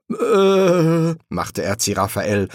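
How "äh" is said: high, drawn-out, sheepish filler; caught-out hesitation. A narrator's reading. Angel asked about his missing sword, sheepish. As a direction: stall guiltily, louder and higher.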